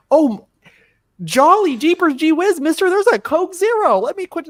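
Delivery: very high, loud golly-gee voice; wholesome 1950s kid. Pretend. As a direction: squeak it like a cartoon goody-goody.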